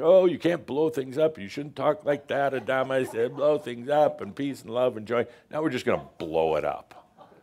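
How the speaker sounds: a hammed-up half scream of alarm; mock screaming human. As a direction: play the panicky human self scolding.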